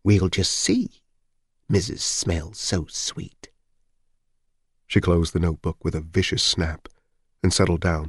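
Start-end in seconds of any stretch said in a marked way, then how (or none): 0.0-3.5 slow, drawn-out musing to herself; neighbour's private, spiteful aside; muttering to herself. A narrator's reading. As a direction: mull it over slowly, to no one. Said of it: "she said to no one".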